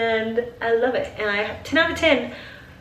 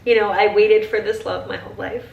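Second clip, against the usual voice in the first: ordinary talk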